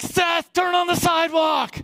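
high, drawn-out mock yell; imitated yell egging on a friend; urging shout. Bored friends telling Seth to turn, urging shout.

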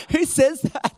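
normal talking voice

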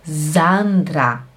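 Sandra starting with a buzzing Z. Shown as a demonstration. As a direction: say "Sandra" German-style, as "Zandra". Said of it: "ele faz som de". (Sandra com som de Z no começo)

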